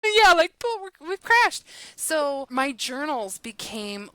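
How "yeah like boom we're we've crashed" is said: high and loud, caught up in the idea; excited agreement. Real, not acted. Guest picks up the host's point, excitedly crying boom. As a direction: throw out the boom with real energy.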